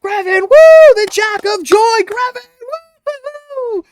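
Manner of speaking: high, loud crowd whooping; the crowd cheering Grevin as he walks on stage. Acted. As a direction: whoop for the new champion. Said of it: "crowd, Oh".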